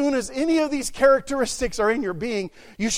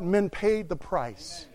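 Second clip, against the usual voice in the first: ordinary talk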